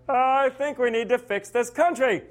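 high-pitched nasally voice; high nasal Lincoln. Retold or imitated. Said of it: "high-pitched, nasally voice".

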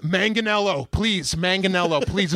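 Manganiello with a clear hard G, pleading; hard G pronunciation. Retold or imitated. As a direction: insist on the G in Manganiello. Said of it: "It's with the G sound".

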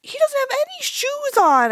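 very high-pitched mimicked complaint; fussing objector. Telling a friend how she always objects, mock high-pitched fussing.

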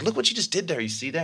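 excited pointing out. Retold or imitated. Pointing out teacher's reaction.

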